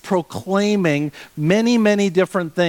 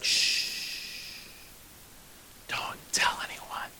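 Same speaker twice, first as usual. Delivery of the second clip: whisper; 15 dB quieter, much slower than usual. Retold or imitated. Jesus hushing people, whispered.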